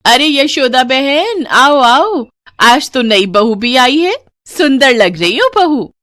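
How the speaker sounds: loud, high, slow welcoming call; effusive hostess greeting. Acted. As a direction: greet the guests with open arms. Hostess greets guests loudly.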